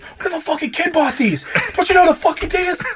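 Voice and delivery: high-pitched, loud, fast tirade; re-enacted screechy blow-up; retold furious yelling. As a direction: yell at the bartender in outrage.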